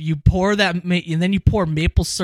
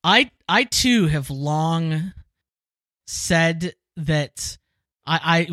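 ordinary delivery

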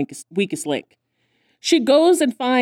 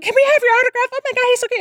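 high, squealing fan voice; gushing fangirl; much faster than usual. Retold or imitated. Foster girls gushing over a celebrity, high and squealing. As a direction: gush like starstruck girls. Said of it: "they just go back to being like".

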